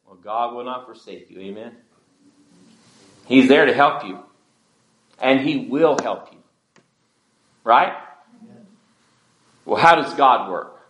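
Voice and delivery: ordinary delivery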